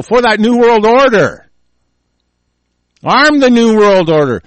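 loud, high, sarcastic slogan; mock proclamation. Real, not acted. Host deriding arms for New World Order, loud sarcasm. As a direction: proclaim it like a scornful rallying cry.

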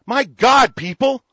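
loud, high, furious outburst; outraged shout. Real, not acted. Radio host ranting at listeners, a loud high furious shout. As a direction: bellow it at the listeners in disbelief. Outraged rant at politicians' promises.

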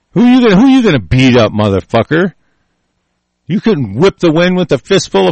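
loud, fast, jeering challenge; furious, mocking dare; angry taunt. Real, not acted. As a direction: bark it at him. Taunting an imagined opponent.

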